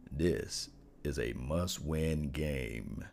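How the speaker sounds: slow, low must-win; deep voice. Shown as a demonstration. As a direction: drop the voice way down, slow. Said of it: "I'm gonna get into my deepest voice".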